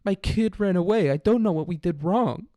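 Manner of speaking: high, bewildered parent parody; whiny distraught parent. Retold or imitated. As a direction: ham up a clueless parent wailing. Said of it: "there's a thousand posters of".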